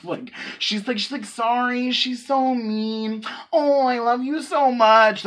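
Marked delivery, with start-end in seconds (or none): 1.3-5.2 high, drawn-out mock cooing; doting owner. Imitating owner hugging her biting dog, doting coo.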